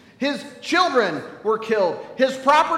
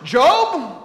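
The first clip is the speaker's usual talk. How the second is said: louder, higher booming rebuke; roused from a nap and indignant; God scolding Job, loud and indignant; grumpy God; 6 semitones higher, 6 dB louder than usual. Acted. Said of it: "And God says".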